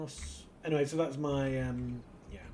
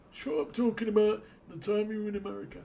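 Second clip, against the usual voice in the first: high, loud heckling viewer voice; mock annoyed viewer; 8 semitones higher, 5 dB louder, faster than usual. Imagined viewer telling the streamer to stop, mock heckle. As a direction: bark it like a fed-up commenter.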